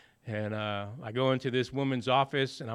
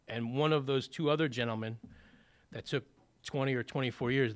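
ordinary talk

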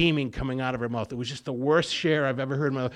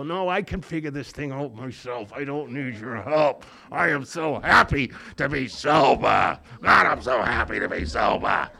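mock stubborn denial; 5 dB louder than usual. Mock denial clinging to control.

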